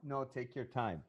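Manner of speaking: talking while chewing gum; words round a piece of gum. Real, not acted. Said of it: "I just put a piece of gum in my mouth".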